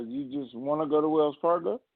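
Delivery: ordinary talk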